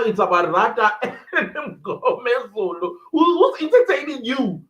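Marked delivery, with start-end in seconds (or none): 3.1-4.6 fast, higher, louder challenge; mock indignant who's entertaining you; indignant retort. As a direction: fire it back in disbelief. Retelling outrage at courtroom.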